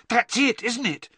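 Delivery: high, eager burst; excited boy, pitched well up. A narrator's reading. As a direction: realise it aloud, excitedly. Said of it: "said Harry excitedly".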